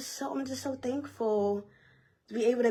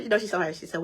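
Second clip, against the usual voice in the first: usual speaking voice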